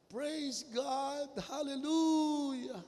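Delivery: put-on a child yelling praise in the empty church; child shouting praise to God, high and loud. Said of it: "Sumisigaw yung bata".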